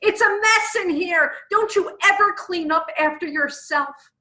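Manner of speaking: high, loud nagging outburst; acted angry scolding, pitched high; flipping her lid. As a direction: blow up like a wife home from a foul day. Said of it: "flips her lid and says".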